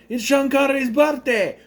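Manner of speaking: playful high-pitched shout; loud, high, hammed-up celebration; mock excited announcement. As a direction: burst out in mock party excitement.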